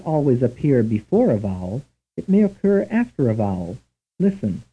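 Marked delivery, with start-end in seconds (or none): none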